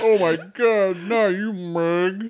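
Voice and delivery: slurred, drawn-out mock wail, high and loud; Mel. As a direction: overact a garbled cry of grief. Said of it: "four times to understand what he said".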